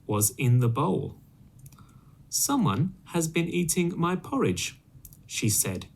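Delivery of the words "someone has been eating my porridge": higher mama bear voice; middle-sized voice. Acted. Mother Bear finding her spoon in the bowl, higher mama voice. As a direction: lift into a gentle mother bear. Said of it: "in a middle-sized voice".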